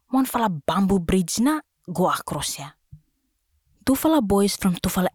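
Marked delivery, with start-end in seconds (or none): none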